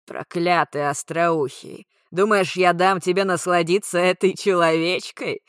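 loud, alien hissing gurgle; hissing gurgling voice. A narrator's reading. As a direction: gurgle and hiss like a tentacled monster. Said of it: "Не то прошипел, не то пробулькал".

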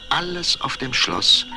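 usual speaking voice

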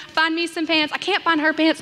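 ordinary talk

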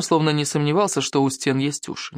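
usual speaking voice

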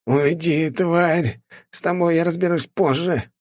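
высокий стон со злобой от боли; простонать, лёжа в пыли; стонущий. A narrator's reading. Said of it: "простонал парень". (простонал с ненавистью)

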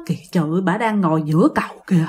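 low, soft, confiding voice; hushed dread. Retold or imitated. Friend telling her companion about the ghost, hushed. Quietly pointing out ghost on bridge.

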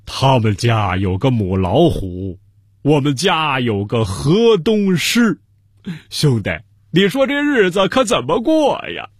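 slow, drawn-out mock lament of a lion king; 痛哭的狮王. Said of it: "竟抱头痛哭起来". (狮王拖长声调，夸张地哭诉)

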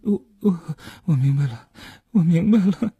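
high, halting, stammered murmur; dazed murmuring. A narrator's reading. Suspect being handcuffed, murmuring and stammering. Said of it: "喃喃地说道".

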